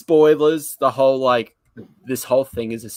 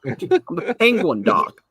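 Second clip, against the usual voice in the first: lightly put-on, higher cartoon penguin voice; mock Frobisher, bit of a silly voice; 7 semitones higher than usual. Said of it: "talking like this".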